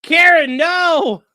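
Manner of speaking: high loud no; scolding the pet, loud; shouted scold. Real, not acted. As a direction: yell to stop the pet.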